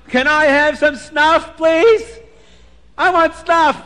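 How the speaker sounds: high, loud demanding plea. Acted. Beggar calls loudly to the bride's father for snuff, loud high plea. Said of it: "he says very loud".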